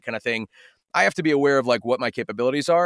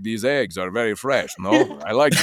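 put-on lower, suave Italian-accented Ezio; 6 semitones lower than usual. Mocking an Ezio Italian accent to a grocery clerk. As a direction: play the smooth Italian charmer. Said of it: "you must do Ezio from Assassin's Creed".

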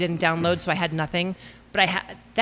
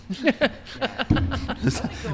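laugh; 5 semitones higher than usual. Real, not acted. Laughing at teasing joke.

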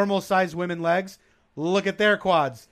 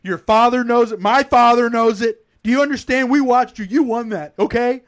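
loud pep talk; 4 semitones higher, 7 dB louder than usual. Retold or imitated. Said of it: "We believe that you're the".

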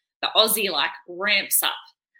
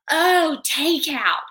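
drawn-out, higher mock dawning; mock realization; 7 semitones higher, much slower than usual. Waitress finally getting what takeaway means, mock realization. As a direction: stretch it as the penny drops. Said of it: "And she was like".

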